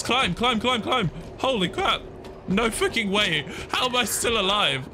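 high-pitched, breakneck astonishment; urge, then marvel, all in a high pitch; frantic disbelief. Real, not acted. Gamer climbing away still alive, high frantic amazement. Urgent escape, amazed survival.

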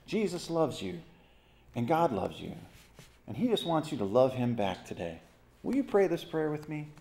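a hint of a syrupy preacher; mock soft gospel pitch. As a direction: lightly mimic a soft, easy invitation. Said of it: "this kind of gospel".

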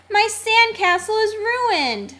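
high-pitched dismayed complaint; dismayed wail. A narrator's reading. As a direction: lament it high, falling at the end. Cleo's sandcastle washed away.

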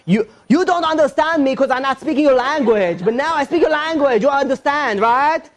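high-pitched put-on Spanish accent. Shown as a demonstration. Speaker trying to speak the listener's language, Spanish accent. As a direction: overdo a Spanish accent to be understood. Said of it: "like, Spanish accent".

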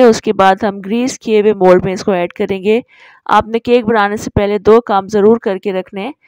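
usual speaking voice

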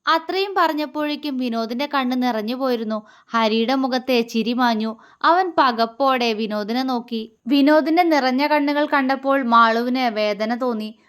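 usual speaking voice

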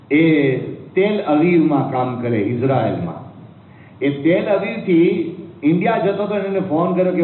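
ordinary talk

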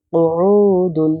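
কুউদুন with a long drawn উ; slow drawn reading. Shown as a demonstration. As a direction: stretch কুউ slowly then দুন. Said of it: "আইন ওয়া পেশ উ". (কুউদুন ধীরে টেনে)

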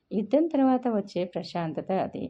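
plain reading voice

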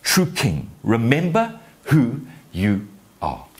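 slow, weighty declaration; father's solemn charge. Acted. Father names Simba the one true king, slow and emphatic. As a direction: space the words out for emphasis. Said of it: "this is what he was saying".

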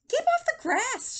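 high, loud, drawn-out order; shouted. A narrator's reading. Hank warns off a cowboy, shouting. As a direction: yell a stretched-out warning. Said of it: "shouted Hank".

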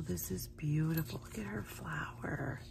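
soft hushed whisper. Real, not acted. Praising Crystal's flower, whispering. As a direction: whisper your admiration.

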